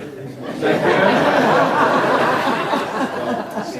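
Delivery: big real burst of laughter, high and loud. Real, not acted. Senator laughing hard after the accent joke, loud real laughter.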